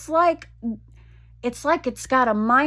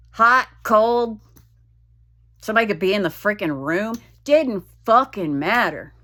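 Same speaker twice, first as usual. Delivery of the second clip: ordinary delivery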